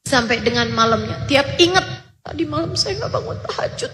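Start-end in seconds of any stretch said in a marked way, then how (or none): 2.2-3.9 crying. Retold or imitated. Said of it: "nangis lagi beliau".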